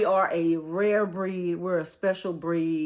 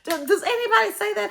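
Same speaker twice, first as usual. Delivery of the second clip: high amused question; 5 dB louder, faster than usual. Real, not acted. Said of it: "laughing at own pronunciation".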